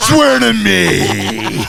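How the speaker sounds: very slow, loud Batman line. Retold or imitated. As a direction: drag out a gruff Batman growl. Quoting Batman's famous line.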